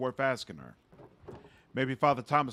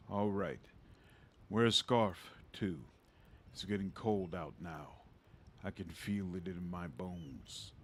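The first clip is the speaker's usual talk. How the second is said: put-on old father voice; 6 semitones lower, 7 dB quieter than usual. Father figure in game dialogue.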